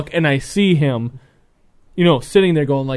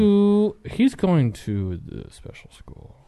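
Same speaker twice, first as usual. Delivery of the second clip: slow, halting mock teacher; imitated teacher; 4 semitones higher, 4 dB quieter, much slower than usual. Teacher breaking news to a dad, imitated slow hesitation. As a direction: drag it out like a reluctant teacher. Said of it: "the teacher comes up to me and goes".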